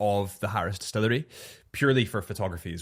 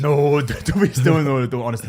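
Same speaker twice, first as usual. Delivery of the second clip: loud, higher, laughing no; real laughing reassurance to the host; laughing protest; 5 semitones higher, 11 dB louder than usual. Real, not acted. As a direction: wave it off loudly with a laugh. Protesting the apology.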